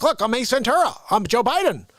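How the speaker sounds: ordinary talk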